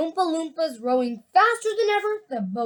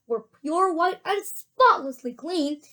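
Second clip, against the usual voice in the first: plain reading voice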